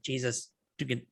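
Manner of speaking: usual speaking voice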